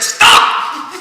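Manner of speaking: loud breathy stop breaking into a laugh. Acted. Indra answers Vishwamitra, loud and laughing. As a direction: counter him, half laughing. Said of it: "And Indra said".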